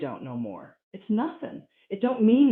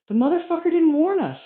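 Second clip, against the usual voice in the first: high, loud buffalo outcry; complaining buffalo; 10 semitones higher, 7 dB louder than usual. Acted. Buffalo grumbling about his herdmate, loud indignant complaint. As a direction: protest loudly as the indignant buffalo. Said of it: "The other buffalo said".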